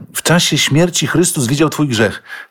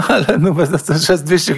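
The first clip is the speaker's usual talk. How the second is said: laughing; 5 semitones higher than usual. Real, not acted. Laughing at the story.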